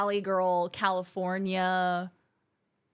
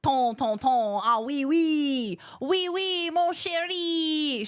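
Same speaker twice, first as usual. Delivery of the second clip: slow, higher mock French flirting; 5 semitones higher, much slower than usual. Streamer parodying the AI's French to chat, hammed-up French. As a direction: ham up a French lover. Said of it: "that Australian Noor".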